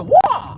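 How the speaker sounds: high, loud karate battle cry; Bruce Lee kiai. Retold or imitated. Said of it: "That's that warning".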